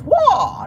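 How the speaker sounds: high, loud karate battle cry; Bruce Lee kiai. Retold or imitated. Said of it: "that's what Bruce Lee used to say".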